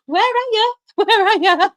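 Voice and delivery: high-pitched mock searching cry; mock calling out. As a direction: call out for someone who forgot you. Retells calling for forgetful driver.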